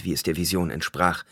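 ordinary delivery, nothing marked

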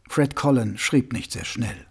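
plain reading voice

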